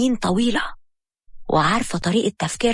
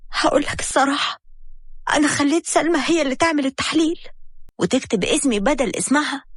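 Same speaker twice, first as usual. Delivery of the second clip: higher, pleading wife begging him; pleading Habiba; 6 semitones higher than usual. A narrator's reading. Wife begging her husband not to leave, higher and pleading.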